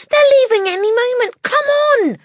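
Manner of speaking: high, loud urgent shout. Acted. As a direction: shout it in a hurry. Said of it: "she shouted".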